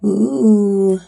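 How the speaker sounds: normal talking voice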